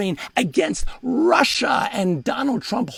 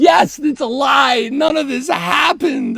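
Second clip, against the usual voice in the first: loud, slow, wailed admission; hammed-up sobbing confession; 4 semitones higher, 7 dB louder, slower than usual. Admitting the lie to reporters, loud mock sobbing. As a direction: wail it out slowly, as if sobbing. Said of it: "And I'm just sobbing".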